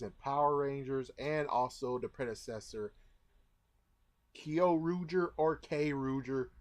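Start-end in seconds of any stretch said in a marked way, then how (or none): 4.3-5.3 "Kyo" said as KEE-oh; pronounced kee-oh. Shown as a demonstration. Reviewer offering viewers possible pronunciations, "Kyo" as kee-oh. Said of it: "which one you want to n-, pronounce". 5.6-6.5 "K" said as plain KAY; pronounced kay. Shown as a demonstration. Reviewer offering viewers possible pronunciations, "K" as kay. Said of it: "which one you want to n-, pronounce".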